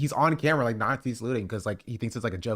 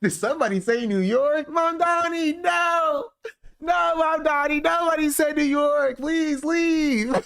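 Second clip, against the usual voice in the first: high hammed-up shout telling him to leave; mock yell; 10 semitones higher than usual.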